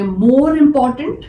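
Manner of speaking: slow, careful comparative form; slow teaching read. Shown as a demonstration. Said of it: "इसमें एक change हो जाता है". (धीमे, ज़ोर देकर more important)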